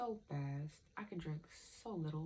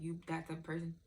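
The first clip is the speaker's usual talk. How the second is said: ordinary delivery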